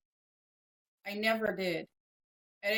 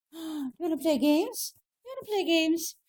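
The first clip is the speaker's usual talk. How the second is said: puppy talk; 8 semitones higher, 5 dB louder than usual. Real, not acted. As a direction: invite a puppy to play, pitched up and loud. Talking to the puppy.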